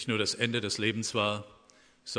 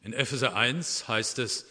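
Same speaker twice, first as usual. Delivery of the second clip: ordinary talk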